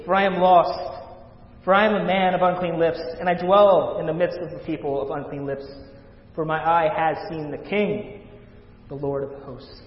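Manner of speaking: loud, impassioned scripture reading; full-force preaching. Acted. Preacher voicing Isaiah before the holy King, full force. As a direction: proclaim the prophet's words with force.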